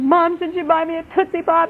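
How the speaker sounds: high-pitched mock-distressed little girl; imitating upset child. Upset daughter asks mom about the promised treat, imitated distress. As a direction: plead like an upset small child. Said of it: "she was very upset".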